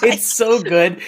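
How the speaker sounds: ordinary talk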